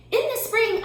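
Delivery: very high mouse; tiny high mouse voice. A narrator's reading. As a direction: squeak it happily. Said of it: "said Mouse".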